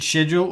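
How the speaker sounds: "schedule" first with sh, then with sk; British or American schedule. Shown as a demonstration. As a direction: contrast "shed-jool" against "sked-jool". Contrasting two pronunciations of schedule.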